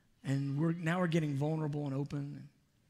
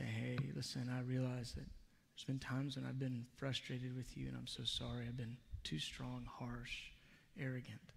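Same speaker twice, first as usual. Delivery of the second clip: soft confession; 7 dB quieter than usual. Retold or imitated. Retold apology to close ones.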